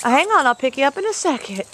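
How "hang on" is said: normal talking voice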